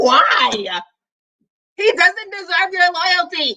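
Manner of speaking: a pleading, frustrated mock shout; talking back to the book, half-yelling; exasperated outburst. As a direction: cry out at the character in disbelief. Pleading with character while reading.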